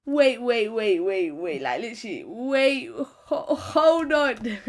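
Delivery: laughing, pleading wait; laughing disbelief. Retold or imitated. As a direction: stall him, cracking up. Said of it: "I said".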